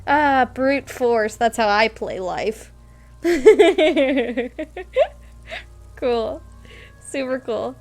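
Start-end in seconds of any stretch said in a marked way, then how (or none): 3.2-5.9 loud, high giddy laughter trailing off; real helpless giggle fit; delighted laugh. Real, not acted. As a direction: laugh till it almost sounds like crying. Laughs at her own brute force.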